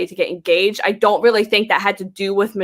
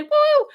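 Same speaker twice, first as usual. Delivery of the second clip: very high-pitched cheer; one high, festive whoop; celebratory woo. Real, not acted. Host wishing happy Saint Patrick's Day, celebratory woo.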